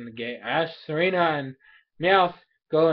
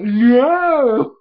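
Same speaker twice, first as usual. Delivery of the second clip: long, high, loud "no"; mock indignant refusal; 9 semitones higher, 12 dB louder than usual. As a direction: wail the refusal dramatically. Character refuses to be scratched.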